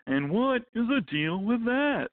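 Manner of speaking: high, slow observational-comic voice; stand-up comic. Retold or imitated. Author mocks a stand-up comic's catchphrase, high and slow. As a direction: sell the setup like a nineties club comic. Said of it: "push my hair back with a cigarette and go".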